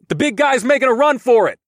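loud, high shout across the fight. Acted. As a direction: yell it out to be heard. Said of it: "I shouted to Amrila".

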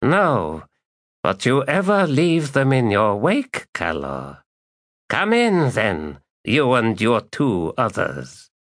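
high, dry, menacing welcome; wry ancient Jagut. Acted. Jagut invites them in.

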